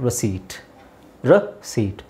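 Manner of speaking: ರಸೀಟ್ as ra-seet, low and with no P; silent P demo. Shown as a demonstration. Teacher answers how it should sound, ರಸೀಟ್ with silent P. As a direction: run the long ee of ರಸೀಟ್ straight into T. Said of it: "ಪಿ is silent".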